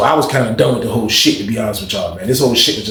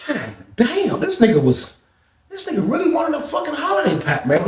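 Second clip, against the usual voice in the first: amused disbelief; 13 semitones higher, 4 dB quieter than usual. Retold or imitated. Amazed comment while laughing.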